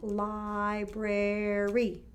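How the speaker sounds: "Library" stretched slowly into three clear beats; syllables tapped out. Shown as a demonstration. Teacher tapping syllables for the class, slow and even. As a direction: tap out "Library" one beat at a time. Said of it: "Tap it out".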